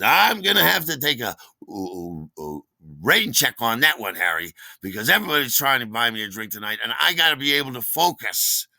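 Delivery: loud, high, hearty bartender; Terry. Retold or imitated. Bartender taking a rain check from a regular, loud and hearty. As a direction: turn down the drink emphatically and cheerfully. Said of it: "our super friendly bartender".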